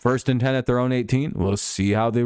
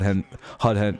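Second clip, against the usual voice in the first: usual speaking voice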